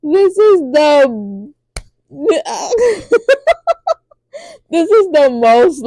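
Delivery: high, giggling squeal of joy; delighted laughter. Retold or imitated. Viewer loving the rebels' downfall, high laughing delight. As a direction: laugh through the words from pure glee. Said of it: "I was like".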